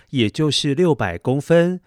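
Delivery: usual speaking voice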